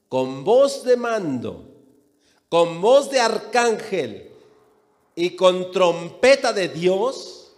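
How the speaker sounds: loud, slow preacher reading scripture; scripture declamation. Acted. Preacher reading the Lord's word to the congregation, declamatory. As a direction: proclaim each phrase of the verse, slowly and forcefully.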